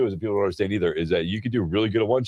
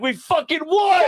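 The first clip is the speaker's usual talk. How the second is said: mock excited yell; 3 dB louder, slower than usual. Friend yelling the win on speaker.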